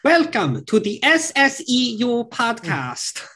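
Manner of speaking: high, slow put-on voice of a podcast friend; Thomas impersonation. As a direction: mimic a friend's high voice, slowly. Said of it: "I can't do a very good Thomas impersonation".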